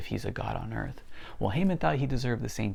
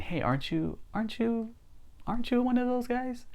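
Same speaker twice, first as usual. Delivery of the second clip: lightly acted accusers in a higher pitch; higher-pitched, probing onlooker voice; questioning bystanders. Voicing bystanders questioning Peter.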